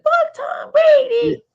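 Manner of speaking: put-on very high, slow little-kid voice; imitating a kid on the porch, high and laughing; kid's voice. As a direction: squeak it out like a small child. Kid on porch curses Brady.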